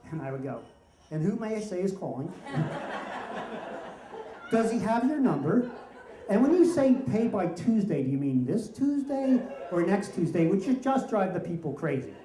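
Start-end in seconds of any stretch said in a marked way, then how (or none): 1.1-10.5 sugary polite, laughing through it; sweetly polite on purpose to annoy; overly polite. Retold or imitated. Teen answering debt collectors, exaggeratedly polite and amused. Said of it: "get really polite".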